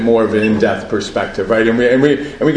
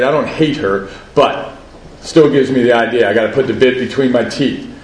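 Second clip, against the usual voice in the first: ordinary delivery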